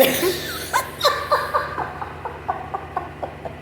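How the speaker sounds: airy giggle rising high; real wheezy giggle; breathy high laugh. Real, not acted. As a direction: let a breathless laugh escape. Laughing at own story.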